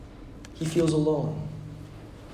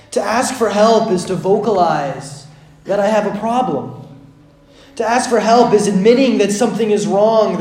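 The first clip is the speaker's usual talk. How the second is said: ordinary talk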